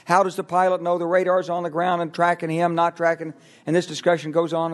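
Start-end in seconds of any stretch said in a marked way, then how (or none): 0.0-3.3 higher-pitched, run-on mock debate; imitated bickering in a raised, steady pitch; mock arguing. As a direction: pile clause on clause like a muddled argument. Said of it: "And they're arguing".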